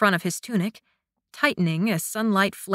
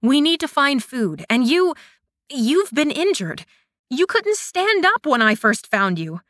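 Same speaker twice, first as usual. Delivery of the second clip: higher, louder, urgent pleading; 5 semitones higher, 4 dB louder than usual. A narrator's reading. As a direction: push it, worried and urgent. She insists, worried and urgent.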